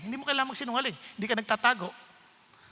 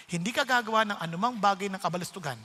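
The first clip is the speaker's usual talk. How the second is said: ordinary talk, nothing marked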